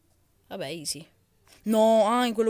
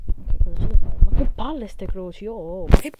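loud frustrated; 2 semitones higher, 11 dB louder than usual. Real, not acted. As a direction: shout at the screen. Yelling at game jumps.

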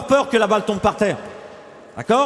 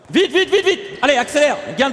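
high, rapid-fire coach's call; urging on; 6 semitones higher than usual. Real, not acted. Coach hurrying players mid-drill, urging on. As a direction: drive them on in a raised voice.

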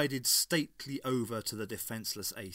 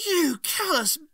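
high, loud, slow outraged cry; acted high-pitched furious yell; 10 dB louder, slower than usual. A narrator's reading. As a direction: hurl the insult in anguished fury. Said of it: "cried Ace".

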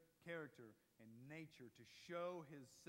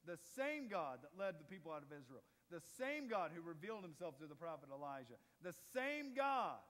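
building sermon climax; 5 dB louder than usual. Acted. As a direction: build the litany to a shout. Building list of the same God.

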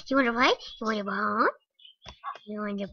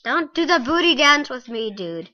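ordinary delivery

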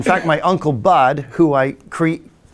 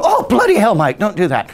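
startled mum; 7 semitones higher, 4 dB louder than usual. Retold or imitated. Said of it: "And she'd go".

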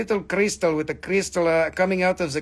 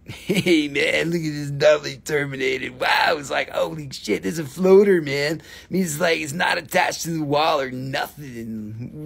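drawling, laid-back "hey, man" voice; stoner dude; 2 semitones lower than usual. Acted. Dude marvelling at a floater crystal, stoner voice. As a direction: play an amazed stoner dude.

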